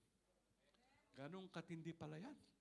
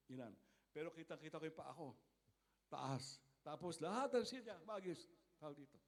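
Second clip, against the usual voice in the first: ordinary delivery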